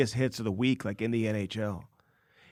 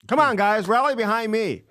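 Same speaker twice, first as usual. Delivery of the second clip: mock rallying cry; 10 semitones higher, 5 dB louder than usual. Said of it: "the game's not over yet".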